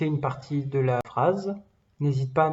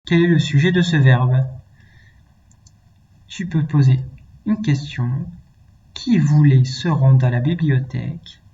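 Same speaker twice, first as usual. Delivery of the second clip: loud; 10 dB louder than usual. Real, not acted. Teacher explaining grammar much louder.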